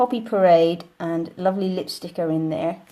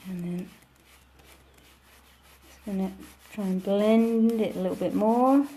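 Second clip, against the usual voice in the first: ordinary delivery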